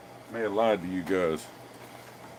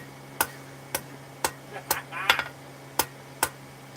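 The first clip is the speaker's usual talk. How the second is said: high chuckle that trails on softly; chuckling; 7 semitones higher, 5 dB quieter than usual. Real, not acted. Host amused by a friend's rude text, chuckling. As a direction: laugh it off in small bursts.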